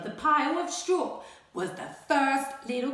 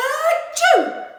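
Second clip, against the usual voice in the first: loud, high exploding achoo; huge sneeze; 13 semitones higher, 9 dB louder than usual. Acted. Wolf sneezing down the stick house. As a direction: burst out a giant sneeze. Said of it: "I sneezed a great sneeze".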